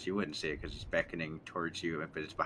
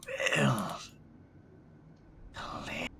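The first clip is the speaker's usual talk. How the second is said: eerie whisper; 3 semitones lower, much slower than usual. Acted. Skinwalker speaks.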